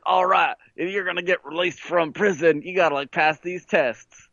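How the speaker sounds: hillbilly cop. Retold or imitated. Hillbilly cop on the speaker briefing a prisoner, Southern imitation. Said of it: "a hillbilly cop voice comes on".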